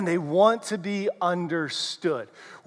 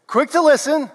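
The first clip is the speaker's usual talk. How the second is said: higher, brisk and lively; quick and bright; 6 semitones higher, 4 dB louder than usual. Shown as a demonstration. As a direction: snap it out quickly and high. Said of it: "would you repeat this after me".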